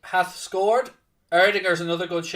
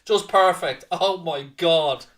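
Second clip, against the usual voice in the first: ordinary delivery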